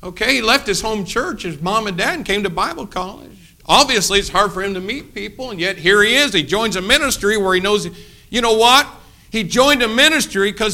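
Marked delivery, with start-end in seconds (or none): none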